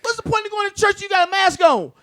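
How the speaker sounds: high, loud, shouting at the top of his voice; fired-up rant. Real, not acted. Ranting to friends about masked churchgoers, shouting furious. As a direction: preach the outrage full force.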